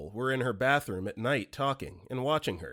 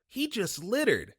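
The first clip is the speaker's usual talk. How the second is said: put-on little kid voice; 7 semitones higher than usual. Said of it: "Three-year-old daughter".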